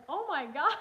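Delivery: shocked. Retold or imitated. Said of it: "and he's like".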